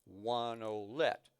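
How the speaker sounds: "One olet" said slowly and evenly, ending on a rise; slow count. Shown as a demonstration. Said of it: "I'll play it really slow so you can hear it".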